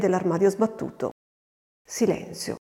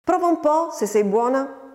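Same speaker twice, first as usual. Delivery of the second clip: shouted challenge; 6 semitones higher than usual. A narrator's reading. Farmhand dares the girl to fight, shouted challenge. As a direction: dare the child to attack, a moderate shout. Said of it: "gridò Francesco".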